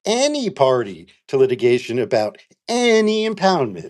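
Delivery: each ANY stretched and stressed; emphatic italics. Shown as a demonstration. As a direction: hit "any" hard both times it comes. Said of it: "in italics".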